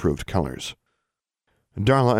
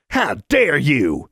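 slow, high, furious bellow; outraged bellow; 8 semitones higher, 4 dB louder, much slower than usual. A narrator's reading. Kicked boy slaps Alexandra, slow furious bellow. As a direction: bellow it, stretching each word in outrage. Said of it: "he bellowed at her".

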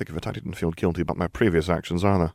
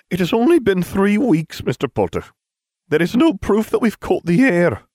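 much higher, louder prim teacher voice; strict old teacher, louder and much higher; stern headmistress; 13 semitones higher, 6 dB louder than usual. A narrator's reading. McGonagall voiced in story.

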